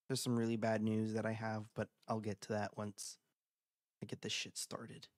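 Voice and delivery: subdued fading voice; quiet and somber. Real, not acted. Bad news, voice drops.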